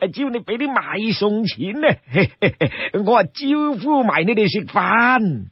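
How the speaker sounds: put-on slow, stretched, delighted old man's voice; chuckling old-timer, swooping and unhurried; obliging old man. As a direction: play a happy old host, dragging the endings.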